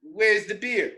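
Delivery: high hillbilly demand; high country voice wanting beer; Jesse demanding. Retold or imitated. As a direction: ask for the beer right away. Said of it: "He said".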